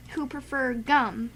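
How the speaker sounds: ordinary talk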